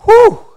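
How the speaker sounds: loud, high whew; whew of relief. Acted. Relief at weight off shoulders.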